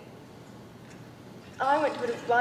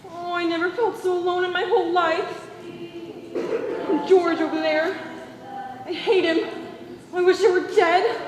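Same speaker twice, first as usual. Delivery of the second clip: panicked cry; 4 semitones higher than usual. Acted. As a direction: let panic build into a cry for Papa. Bride's panic, calls father.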